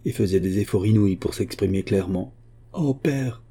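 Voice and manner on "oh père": anguished cry. A narrator's reading. Daughter appeals to her father, anguished cry. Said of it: "s'écria-t-elle".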